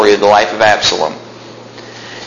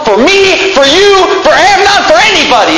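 fiery preaching; 9 semitones higher than usual. Acted. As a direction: preach it up to the rafters. Preaching at full force.

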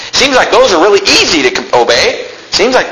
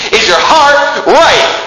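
higher and slow, stretched on right; slow emphatic question; 10 semitones higher, much slower than usual. Acted. Preacher quotes the Bible's question slowly and emphatically. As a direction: lean into each word of the question. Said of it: "That's what he said".

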